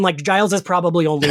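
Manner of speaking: usual speaking voice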